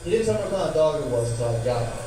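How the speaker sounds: normal talking voice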